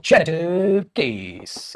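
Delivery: very slow, stretched syllables; long, lingering build-up on each word; drawn-out announcement. Shown as a demonstration. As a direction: announce the heading, drawing out every word. Announcing the new case heading.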